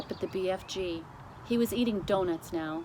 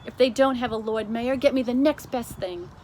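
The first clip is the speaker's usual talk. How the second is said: a lightly voiced queen, commanding; brisk royal order, a little louder; queen giving an order down the phone; 4 semitones higher, 8 dB louder than usual.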